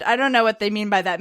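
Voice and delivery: usual speaking voice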